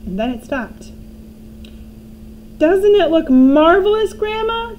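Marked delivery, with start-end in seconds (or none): 2.6-4.7 high, loud delighted call; boy shouting. A narrator's reading. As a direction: call up proudly. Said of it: "George shouted".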